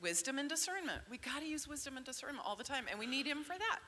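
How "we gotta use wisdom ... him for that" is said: pressured rushing speech. Real, not acted. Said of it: "talking a mile a minute".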